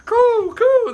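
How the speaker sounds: high, loud imitation pigeon coo; mock bird call. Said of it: "Those pigeons are all lined up".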